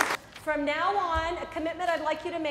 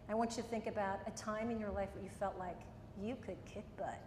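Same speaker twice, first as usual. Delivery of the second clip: guided visualization; 5 semitones lower, 10 dB quieter than usual. Acted. Guides a power memory.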